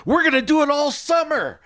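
overly enthusiastic. Retold or imitated. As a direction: gush it like the captain can't wait. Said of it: "a little too much enthusiasm".

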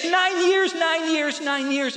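high, overwrought cry, acted; imitated weeping. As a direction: imitate a woman weeping as she repeats herself. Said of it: "and she's weeping".

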